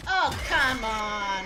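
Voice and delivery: drawn-out exasperated yell; frustrated shout. Real, not acted. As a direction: groan it out, fed up. Game death frustration.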